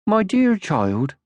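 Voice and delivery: high, very slow gentle mother; patient motherly voice; Moomin Mama. Acted. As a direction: draw it out kindly.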